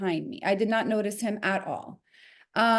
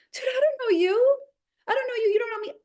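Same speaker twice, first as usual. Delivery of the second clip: exasperated outburst; 12 semitones higher, faster than usual. Retold or imitated. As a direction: fling it back at him, high and exasperated. Outburst at the man.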